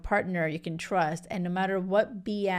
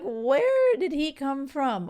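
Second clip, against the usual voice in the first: amazed disbelief; 6 semitones higher, slower than usual. Retold or imitated. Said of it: "I'm like".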